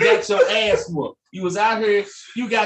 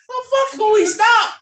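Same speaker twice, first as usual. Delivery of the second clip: mock angry owner; 12 semitones higher, 4 dB louder than usual.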